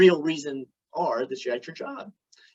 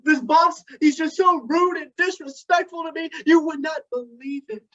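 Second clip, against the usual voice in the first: a higher, upset wife's voice; Cindy's voice; 8 semitones higher than usual. Retold or imitated. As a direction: complain about the rude boss. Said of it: "Cindy's like".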